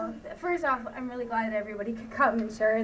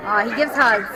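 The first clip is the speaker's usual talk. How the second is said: ordinary talk, nothing marked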